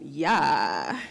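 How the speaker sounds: ordinary talk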